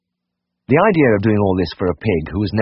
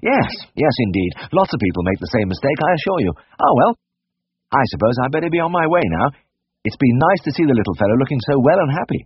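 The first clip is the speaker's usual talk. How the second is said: higher-pitched, hearty professional reassurance; brisk, businesslike; 7 semitones higher than usual. A narrator's reading. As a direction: cover her embarrassment by briskly wrapping up the visit. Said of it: "I became very businesslike".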